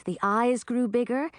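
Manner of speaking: plain reading voice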